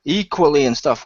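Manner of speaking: put-on protest, lightly jeering; mock fan complaint. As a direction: imitate a whinging fan. Mimicking complaining fans.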